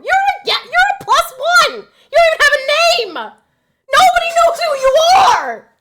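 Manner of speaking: high, loud mock wail of scorn; mock the plus one in a loud, high, wailing voice; mocking a nobody guest.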